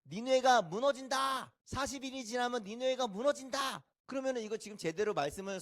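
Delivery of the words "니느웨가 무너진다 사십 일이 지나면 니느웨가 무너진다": high-pitched rote street cry; perfunctory proclamation. Acted. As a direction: call out the warning as a chore. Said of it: "대충 선포하는 거예요". (건성으로 외치는 선포)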